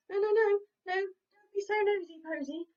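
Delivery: high, loud, quacky pleading; quacking ducks. Acted. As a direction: flap and quack in worry. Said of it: "quacked Dilly and Dally".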